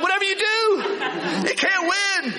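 high-pitched, fast exasperation; exasperated complaint. Acted. Preacher mimicking someone making excuses, exasperated. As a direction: throw up your hands in mock exasperation.

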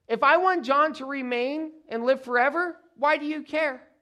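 high, loud challenging question; exasperated rebuke. Acted. Jesus rebukes Peter for caring about John, exasperated. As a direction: push back hard at a follower's meddling.

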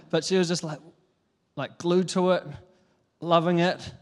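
normal talking voice

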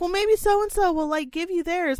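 high, sugary helpful voice; chirpy teacher. Retold or imitated. Teacher suggesting someone share their drink, high and sweet. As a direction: offer a fix in a bright high tone. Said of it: "She's like".

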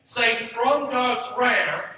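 slow, loud, with "from" hit hard; emphatic repeat. Shown as a demonstration. Preacher repeats for congregation, emphatic and slow. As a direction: hammer "from" again, slowly. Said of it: "but listen to me".

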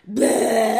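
loud, ugly bleating blurt; mock animal noise. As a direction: mimic a gross animal cry. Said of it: "you think every animal goes".

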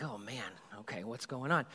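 soft, deflated sigh of impatience; weary grumble. Retold or imitated. Stuck passenger grumbling to himself, soft and weary. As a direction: mutter it, fed up with waiting.

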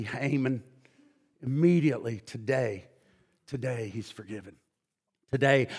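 soft, low, intimate sermon voice; hushed preaching. Acted. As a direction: draw them in close and quiet. Softer intimate preaching stretch.

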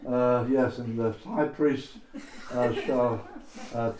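ordinary delivery

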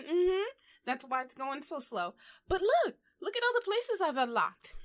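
high, eager bragging voice; showing off. Retold or imitated. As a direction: proudly show off to friends. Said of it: "I just go through it like".

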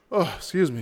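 real yawn into an apology; words stretched by a yawn. Real, not acted. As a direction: excuse yourself through a yawn. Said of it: "Sorry about the yawn".

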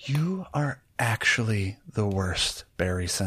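slow, quiet, deliberate put-down; disgusted retort. Acted. Juliet answering Barry's yell, quietly disgusted.

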